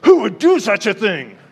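the king, furious at the plot, cries out in a rage; king's rage. Acted. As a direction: bellow it like an outraged king. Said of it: "the king gets really upset and kind of gets in a rage".

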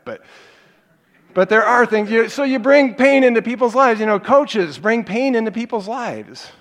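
loud, high, impassioned sermon delivery; fervent pulpit preaching, loud and high; preaching at full force. Acted. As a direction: drive the point home to the congregation. Fervent preaching on pain and good.

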